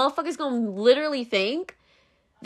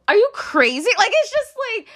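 high, incredulous, laughing; can't believe what she's hearing; exasperated disbelief; 10 semitones higher than usual. Real, not acted. Exasperated rant.

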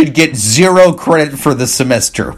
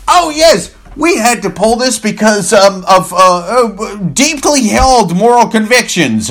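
mock corporate spokesman; 7 semitones higher than usual. Mocking the soulless corporation, high smarmy spokesman voice. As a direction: play a pious, insincere PR flack. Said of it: "they're going".

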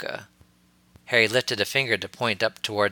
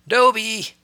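loud high call across the room; 13 semitones higher, 7 dB louder than usual. A narrator's reading. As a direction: call him loudly. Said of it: "Harry called out".

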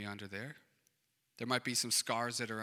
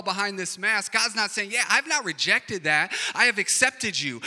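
loud, driving preacher voice, higher and louder; preaching at full force; 7 semitones higher, 10 dB louder than usual. Acted. Pastor urging the congregation that God accepts them, in a loud, fervent preaching voice. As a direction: preach it out to the whole church.

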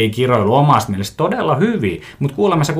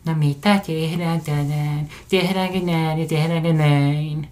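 imitated talk to a partner; 4 semitones higher, 5 dB quieter than usual. Someone asking their partner about today's plans, softly imitated sweet talk. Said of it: "muuttaa vielä ääntä tota omalle puolisolle".

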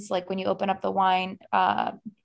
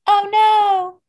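mock gasp of alarm; 12 semitones higher, 9 dB louder than usual.

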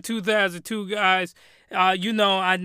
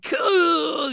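higher, drawn-out cry, hammed up; mock strained cry; 7 semitones higher, 4 dB louder than usual. Podcaster imitating the cartoon man, mock strained cry.

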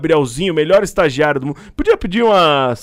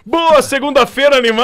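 high cheerful mock greeting; mock the viewers' cheery greeting; 10 semitones higher than usual. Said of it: "os piá começaram falando assim". (saudação animada debochada)

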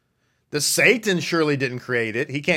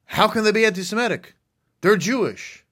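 higher, quicker mock incredulous question; mock disbelief; 7 semitones higher, 3 dB louder than usual. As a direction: play the shocked listener, pitched up. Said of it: "And they'll say".

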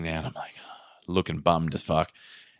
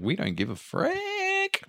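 high, slow mock exasperation; 5 dB quieter, slower than usual. Retold outburst at staff.

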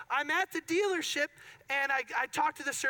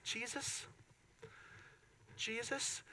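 much softer, under the breath; quiet dread; 14 dB quieter, slower than usual. Retold or imitated. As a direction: murmur it, bracing for bad news. Watching the screw come out.

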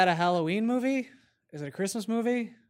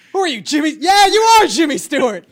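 higher, louder mock yell at an imposter; mock shout; 7 semitones higher, 11 dB louder than usual. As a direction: shout it out like catching a fake. Mock confrontation in retold scene.

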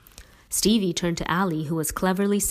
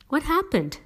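high-pitched eager question; wide-eyed curiosity, voice jumping up; all agog; 10 semitones higher than usual. A narrator's reading. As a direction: ask it agog for the gossip. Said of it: "Allie was all agog".

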